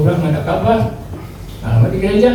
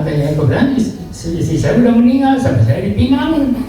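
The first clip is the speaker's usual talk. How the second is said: ordinary talk, nothing marked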